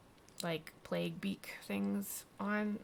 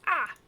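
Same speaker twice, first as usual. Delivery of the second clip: short, high, loud cry of surprise; mock jump-scare "ah"; startled yelp; 10 semitones higher, 9 dB louder than usual. Wake spooked by the cat lady, startled yelp.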